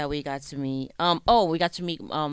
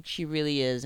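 ordinary delivery

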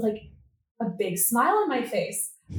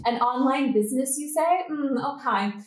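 high-pitched sceptical query; patronising, raised-pitch disbelief; mock dismissive; 4 semitones higher than usual. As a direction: imitate a condescending doubter. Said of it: "were, like, kind of dismissive".

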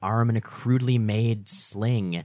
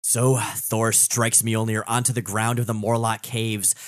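normal talking voice

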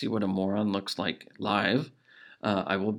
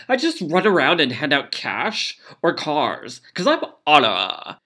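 higher, louder bubbly perky voice; perky Anna voice; 5 semitones higher, 7 dB louder than usual. Retold or imitated. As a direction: chirp it like a perky squirrel. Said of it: "that perky little squirrel".

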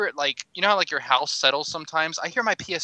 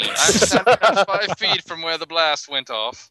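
slow, loud, raised news reporter; field reporter; 5 semitones higher, 6 dB louder, slower than usual. Retold or imitated. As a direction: report live from the scene. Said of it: "sound like a reporter live on the scene".

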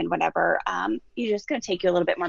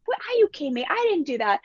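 put-on high, incredulous teen denial; a defensive, disbelieving snap; indignant scoff; 7 semitones higher than usual. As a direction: scoff like a sister caught out. Sister scoffs with crossed arms.

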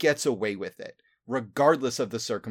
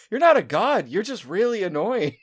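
deadpan monster; 9 semitones higher, 5 dB louder than usual. Pretend. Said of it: "It's like".

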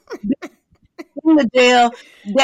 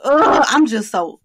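usual speaking voice

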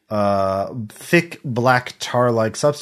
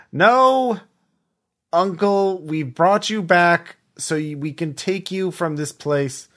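firm niece; 7 semitones higher than usual. Acted. Niece refusing uncle in acted exchange.

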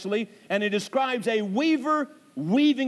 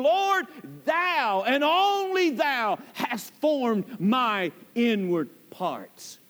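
"thou" and "only thou" stressed hard and drawn out slowly; emphatic thou; much slower than usual. Shown as a demonstration. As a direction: lean on every "thou", slow and deliberate. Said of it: "Thou that is used there is highly emphatic".

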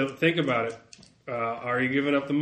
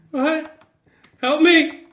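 desperate high screams for help; overlapping cries; 14 dB louder than usual. Acted. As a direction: yell in panic, very high. Captive man screaming for help.

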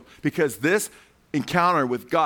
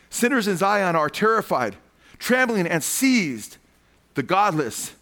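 forceful scripture reading; 5 semitones higher, 3 dB louder, slower than usual. Real, not acted.

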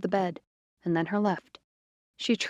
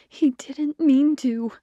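higher, louder and slow, pleading the excuse; insistent repeat; 6 semitones higher, 5 dB louder, slower than usual. A narrator's reading. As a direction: lean hard on it, begging her to believe. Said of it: "the familiar first voice repeated".

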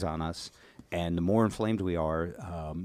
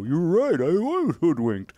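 acted quote; 8 semitones higher, 6 dB louder, slower than usual. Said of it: "then someone would go".

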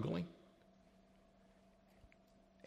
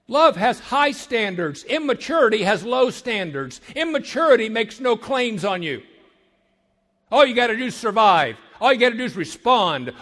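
louder, driving preacher cadence hammering each line; rising pulpit preaching, louder and louder; full-force preaching; 5 semitones higher, 6 dB louder than usual. Acted. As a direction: build the sermon to a loud peak.

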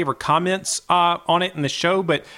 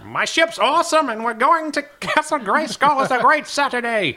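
joyful Skeletor; 7 semitones higher than usual. Retold or imitated.

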